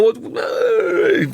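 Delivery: high drawn-out mock grumble; mock grumpy roommate. Podcaster imitates a mad roommate answering a greeting, high irritated noise.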